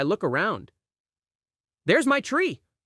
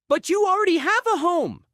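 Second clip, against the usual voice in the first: raised, high call to a friend nearby; 10 semitones higher than usual. A narrator's reading. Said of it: "I call to him".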